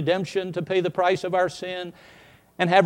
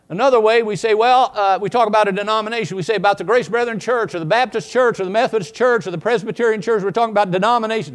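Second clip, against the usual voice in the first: louder and higher, fast; preacher listing the wrong uses; emphatic preaching; 5 semitones higher, 6 dB louder, faster than usual. Acted. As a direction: drive the point home to the pews. Loud preaching on wrong usage.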